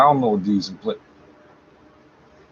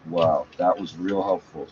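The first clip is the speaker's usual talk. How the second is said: sarcastic; 7 semitones lower, 4 dB quieter than usual. Real, not acted. Sarcastic remark at a broken interface.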